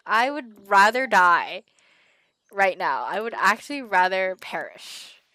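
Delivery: loud, slow, hammed-up disgust; drawn-out, theatrical horror; mock dramatic refusal. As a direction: act out refusing with heavy emphasis. Acted emphatic refusal to him.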